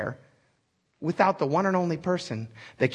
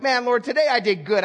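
high, upbeat mock prayer; imitating a self-satisfied believer; pleased with oneself; 6 semitones higher than usual. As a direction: proudly report a good day to God. Voicing a self-satisfied believer praying.

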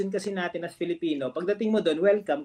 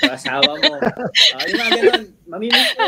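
high, loud, through laughter; laughing; 10 semitones higher, 11 dB louder, faster than usual. Real, not acted. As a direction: talk while cracking up. Joking about missing family nagging. (natatawa habang nagsasalita)

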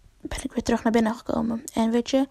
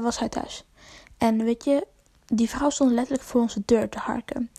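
normal talking voice

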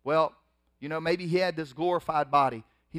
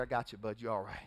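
soft, low, gentle check-in; tender reassurance; 6 semitones lower, 9 dB quieter, faster than usual. Retold or imitated. Jesus to risen Lazarus, soft tender reassurance. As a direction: quietly comfort your friend.

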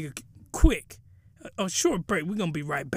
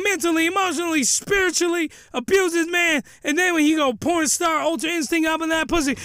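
fast, raised ranting at her; indignant rant; 7 semitones higher than usual. Real, not acted. Ranting at the character.

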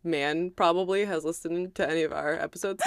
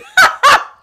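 loud high laugh; 17 dB louder than usual. Real, not acted. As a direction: burst of shrill laughter. Laughing at own story.

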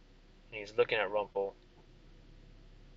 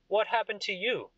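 high-pitched royal question; curious king; 10 semitones higher than usual. Acted. King questioning Titan, curious. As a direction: ask it pitched way up. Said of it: "and he goes".

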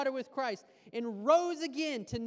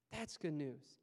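low, softer repeat; soft echo; 8 semitones lower, 9 dB quieter, slower than usual. Acted. Pastor quietly repeating the good news, softer. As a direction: let it land gently.

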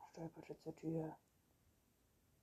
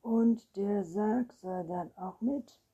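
slow, loud, higher anxious question; raised, worried voice; trembling voice; 4 semitones higher, 13 dB louder, slower than usual. A narrator's reading. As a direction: ask it in alarm, drawn out. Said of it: "wandte er sich mit zitternder Stimme".

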